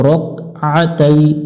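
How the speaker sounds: slow রকআতাই with no vowel after k; careful রকআতাই with a throat stop; correct Arabic. Shown as a demonstration. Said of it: "ভুল করে থাকি আমরা".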